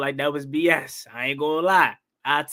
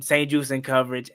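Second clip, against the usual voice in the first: normal talking voice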